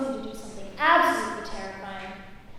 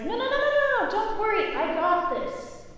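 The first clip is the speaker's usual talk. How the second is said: much higher, rushed no no no; panicky reassurance; 9 semitones higher than usual. Retold or imitated. Student stops the instructor's GPS, panicky and high. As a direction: wave off his worry in a panic. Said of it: "I was like".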